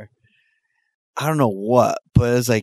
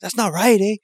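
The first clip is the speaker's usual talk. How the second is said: higher mock girlfriend; girlfriend complaining; 8 semitones higher than usual. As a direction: complain about the mother. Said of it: "so she's like".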